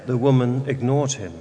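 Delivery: ordinary talk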